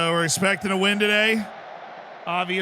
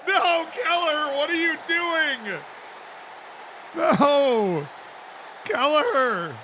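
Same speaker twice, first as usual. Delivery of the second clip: shocked dismay; 11 semitones higher, much slower than usual. Real, not acted. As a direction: cry out in disbelief. Dismay at a defensive error.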